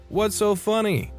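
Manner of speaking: put-on holler, pitched well up; mock yell. A narrator's reading. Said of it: "yell in his ear".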